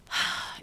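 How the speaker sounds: audible sighing exhale; long sigh to let go; releasing breath. Shown as a demonstration. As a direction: breathe out fully in one sigh. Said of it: "Let's take a breath".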